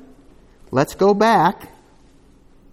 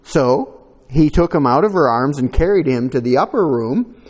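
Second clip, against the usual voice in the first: raised, louder reading voice; solemn Bible reading; scripture reading; 2 semitones higher, 4 dB louder than usual. Acted. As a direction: read the passage out to the church. Reads scripture aloud.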